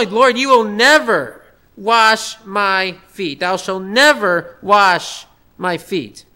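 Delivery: slow, never stressed each time; slow insistent refusal. Retold or imitated. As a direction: drag out never, lightly voicing Peter. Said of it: "arrogant disposition".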